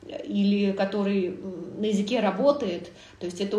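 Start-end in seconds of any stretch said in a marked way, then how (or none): none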